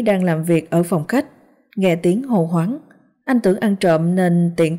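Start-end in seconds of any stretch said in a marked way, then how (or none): none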